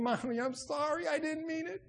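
high, thin-volume plea rising at the end; plaintive apology. Acted. Daughter still apologising to dead mother, plaintive. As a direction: plead it high, without shouting.

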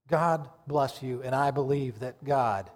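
usual speaking voice

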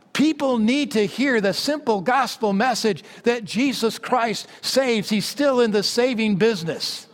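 preaching. Acted.

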